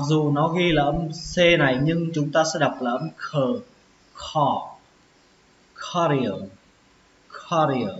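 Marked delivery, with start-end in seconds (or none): 5.7-7.9 "carrier" stressed on CA, falling after; "carrier" with a K start and stressed opening; first-syllable stress. Shown as a demonstration. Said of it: "trọng âm của nó sẽ là âm đầu tiên".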